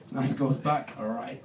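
ordinary talk, nothing marked

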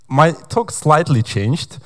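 usual speaking voice

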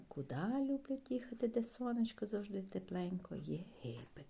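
ordinary talk, nothing marked

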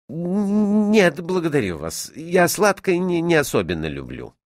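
hesitant, high-pitched polite refusal; flustered student. A narrator's reading. Student turns down the offered jam, awkwardly polite. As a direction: stammer a little while declining.

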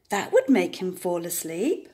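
ordinary delivery

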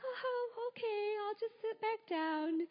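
high, small voice of mock acceptance; mortified. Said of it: "I just wanted to melt".

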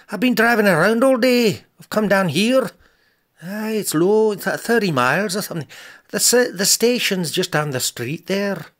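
loud, high gruff Scottish voice; gruff Scottish lord. Acted. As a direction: bellow it like a Highland laird. Scottish garage man on phone.

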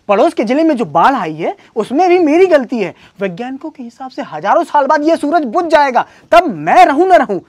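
escalating tirade, high and loud; exasperated sarcastic rant. Acted. Husband lists absurd things wife blames him for, exasperated rant.

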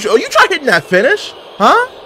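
high-pitched indignant challenge; outraged, squeaky questioning of an opponent; incredulous squeal. Real, not acted. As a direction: pitch leaps way up in disbelief. Reacting to opponent's finisher attempt.

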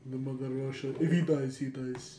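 subdued, hammed-up Russian accent; mock Russian accent. Shown as a demonstration. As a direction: play a stoic villain with a Russian accent. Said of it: "But it's more like".

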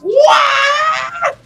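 high, loud disbelieving shout; shouted what. Real, not acted. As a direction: yell it in disbelief. Outburst at game.

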